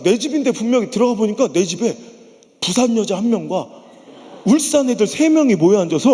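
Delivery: ordinary talk, nothing marked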